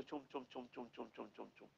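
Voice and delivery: soft chum chum fading away to almost nothing; anklet jingle. Retold or imitated. Gopal telling the Brahmin how his anklets will sound, soft jingle fading out. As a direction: imitate anklet bells walking off into the distance. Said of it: "हमारे पायल की आवाज".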